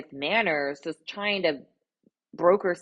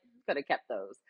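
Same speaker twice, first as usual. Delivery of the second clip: dry dismissal; 5 semitones lower, 7 dB quieter than usual. Retold or imitated. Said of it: "I was like".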